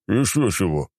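a touch of a smirk; smirking scoff. Acted. Count scoffs at the commander, mildly smirking. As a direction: scoff it lightly. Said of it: "усмехнулся тот".

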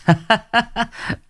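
high gleeful cackle of a thug. Acted. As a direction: cackle high as you slap his shoulder. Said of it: "Thằng Hào vỗ mạnh vai bạn".

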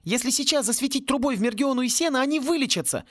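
high, excited near-shout; almost shouting. A narrator's reading. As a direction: nearly yell the solution. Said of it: "почти прокричал он".

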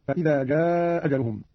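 "جاء أجلهم" with one hamza and a long aaa; dropped hamza, long. Shown as a demonstration. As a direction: stretch the vowel of "جاء" into "أجلهم". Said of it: "بإسقاط الهمزة الأولى مع القصر والمد".

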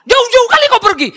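very loud, high Batak holler; shouted Medan Batak greeting; Batak speech. Shown as a demonstration. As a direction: banter that sounds like a Batak brawl. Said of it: "orang Batak ngomong, antara bercanda sama berkelahi bingung".

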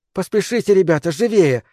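high, urgent hurry-up call; urging on. Acted. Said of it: "подгоняла нас Герлиц". (торопит, подгоняет)

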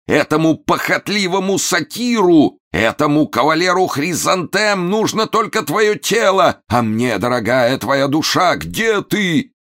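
high melodramatic lament, drawn out; wailing. A narrator's reading. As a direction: call to an absent beloved in anguish. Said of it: "завопил Момус, обращаясь к невидимой Адди". (надрывно взывает к любимой)

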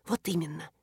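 sharp, hushed hiss. A narrator's reading. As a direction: hiss it through the teeth, hushed. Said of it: "зашипела Аньяна".

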